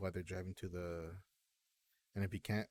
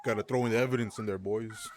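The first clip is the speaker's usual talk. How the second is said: mock cop; 3 semitones higher, 12 dB louder than usual. Mocking cops planting evidence.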